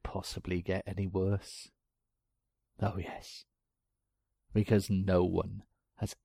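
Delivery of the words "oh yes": breathy menacing whisper. A narrator's reading. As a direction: hush it like a secret. Menacing aside in story.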